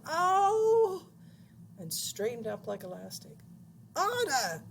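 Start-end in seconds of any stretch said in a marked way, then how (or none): 0.1-1.0 very loud, high howl of pain; long high "ow" yell; yelp of pain. A narrator's reading. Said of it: "and he yelled". 3.9-4.6 loud, high screech; gleeful shriek. A narrator's reading. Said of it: "shrieked a voice from over in the corner".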